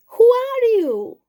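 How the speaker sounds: small creature calling out high and loud; a bright, eager little mouse asking who he is. Acted. Little mouse asks lost boy.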